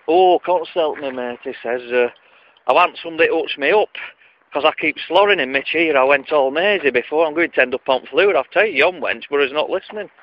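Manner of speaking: put-on an old Wigan patient complaining; Wigan accent. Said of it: "an accent out of a Wigoner".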